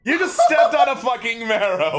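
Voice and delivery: loud, high, laughing yell; laughing shout. Real, not acted. Laughing at the marrow mishap.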